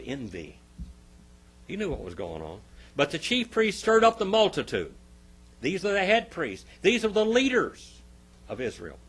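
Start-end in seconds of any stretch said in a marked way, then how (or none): 3.0-9.0 raised, loud pulpit voice; forceful preaching. Acted. Preacher accusing the chief priests before the congregation, forceful preaching. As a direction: drive each phrase home from the pulpit.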